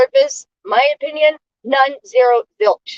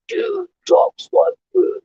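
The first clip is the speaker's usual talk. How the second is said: mock macho voice, higher and slow; fake tough guy; 8 semitones higher, slower than usual. Mocking a twerp who puts on a heavy-duty voice, hammed-up tough guy. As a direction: ham up a puffed-up tough dude. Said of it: "using this heavy-duty voice, acting like he's some".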